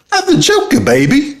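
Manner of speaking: high falsetto quote; hammed-up squeaky Joker mimicry; imitating clip's Joker guy. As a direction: squeak it, easing down at the end. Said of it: "He's like".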